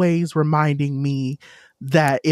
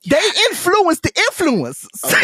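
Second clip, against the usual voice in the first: laughing through the words, pitched up high; 4 dB louder than usual. Real, not acted. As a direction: giggle at the joke as you say it. Laughing at own wordplay.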